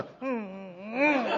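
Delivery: rising hummed motor revs; imitated car engine. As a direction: hum a car peeling out on gravel. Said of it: "I'm gonna spin gravel and go".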